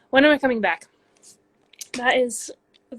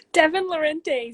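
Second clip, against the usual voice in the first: calling out; 6 semitones higher, 6 dB louder than usual. Real, not acted. Calling out to a friend.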